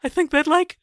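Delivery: very high squeaky cartoon fright; scared cartoon squeal at a slammed door; Shaggy. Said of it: "get you to say zoinks".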